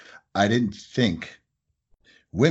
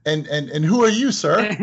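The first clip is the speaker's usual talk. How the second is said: higher, louder mock customer asking; curious customer; 5 semitones higher, 4 dB louder than usual. Owner mimics the caller asking who he is.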